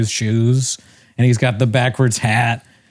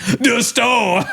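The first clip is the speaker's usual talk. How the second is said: high, exaggerated echo of the answer; mimicking comic's outburst; 11 semitones higher than usual. Comic repeating an audience member's answer, high mocking exclamation. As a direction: parrot it back high in mock astonishment. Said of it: "And he's like".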